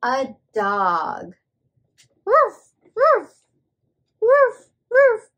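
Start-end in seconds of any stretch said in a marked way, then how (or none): 2.3-5.3 high, loud playful puppy woofs; squeaky loud pretend barking; dog bark. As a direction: bark like an excited little dog. Dog sound for a child.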